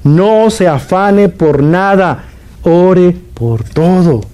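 very slow, every word spaced out; slow repeat. Shown as a demonstration. Preacher repeating for listeners who missed it, very slow and deliberate. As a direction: repeat it slowly so they finally hear. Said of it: "lo repito porque usted no me escuchó bien".

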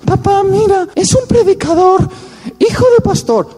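put-on high, eager girlish daughter. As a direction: play the excited daughter introducing her boyfriend. Girl presents boyfriend to father.

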